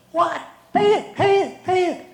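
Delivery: high, loud squawking demand; eager parrot. Acted. Parrot urging owner to pay, high squawk.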